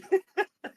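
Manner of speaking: short, high real laugh; hard laughing. Real, not acted. Laughing at the other person on the call, uncontrollable giggle. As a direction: break up laughing helplessly. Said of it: "laughing so hard".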